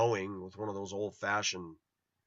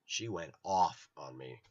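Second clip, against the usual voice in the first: ordinary talk, nothing marked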